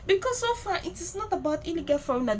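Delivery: usual speaking voice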